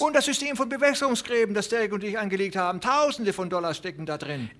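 put-on an old man rushing out his worry; aufgeregter alter Mann. A narrator's reading. Old farmer voiced. (viel höher, hastig)

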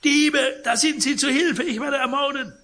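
high, loud terrified scream of thieves; piercing scream. Acted. Sick old man wakes screaming at the thieves. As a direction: shriek for help in panic. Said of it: "stieß einen durchdringenden Schrei aus".

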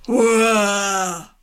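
loud, drawn-out cheer; cheer from outside. Acted. Kangaroo cheers outside the nursery, loud. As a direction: call hurrah gleefully. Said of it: "machte es draußen".

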